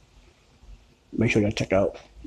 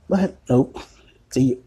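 protesting woman; 3 semitones higher, 3 dB louder, slower than usual. Retold or imitated. A light woman's voice, her protesting that she showered. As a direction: squeal her protest in a raised voice.

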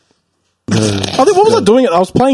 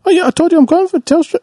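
imitating phone chat; 10 semitones higher than usual. Imitating scam call exchange.

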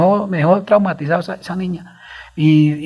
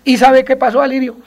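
excited; 9 semitones higher, 5 dB louder than usual. Real, not acted. Building to a surprising outcome.

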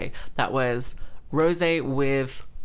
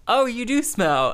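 mocking mother; 8 semitones higher, 4 dB louder than usual. Mother's teasing line, retold.

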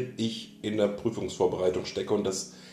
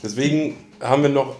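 mouth full; 4 semitones higher, 6 dB louder than usual. Real, not acted. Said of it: "Mit vollem Mund podcastet man nicht".

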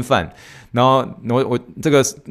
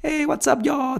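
squeaky high Texan greeting; high Texan accent; 3 dB quieter, slower than usual. Shown as a demonstration. Demonstrating the Texan greeting again, high and Texan. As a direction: pitch way up on What's up y'all. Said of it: "很典型的德州腔的例子".

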